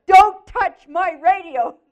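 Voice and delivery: loud, slow mock-angry yell; mad. Husband warning his wife off his car radio, mad. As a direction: imitate a furious husband, each word shouted high. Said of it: "He was so mad at me".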